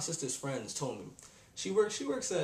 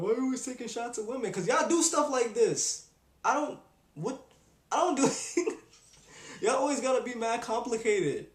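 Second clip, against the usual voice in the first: higher, louder heated rant; 7 semitones higher, 4 dB louder than usual. Real, not acted. Answering critics of taking shots at women, higher heated rant. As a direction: let the irritation pour out.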